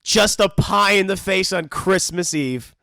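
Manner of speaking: ordinary delivery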